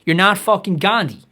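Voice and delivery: ordinary talk, nothing marked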